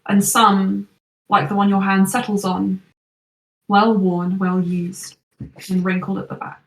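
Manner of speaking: lingering over each book description; story narration. A narrator's reading. GM describes shelf of journals.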